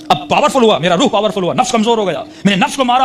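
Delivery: rising high preaching fervour; fervent build. Acted. (جوش سے بلند ہوتی آواز)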